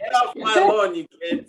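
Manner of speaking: high, loud cranky geezer yell; grumpy old man. Pretend. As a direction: shoo the kids off like an old grump. Jokes along with old-timer bit.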